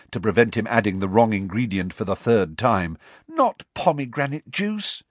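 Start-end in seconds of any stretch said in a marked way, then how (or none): 3.3-5.0 high-pitched moaning correction; moaned. A narrator's reading. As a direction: plead in an exasperated, raised pitch. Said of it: "Hermione moaned".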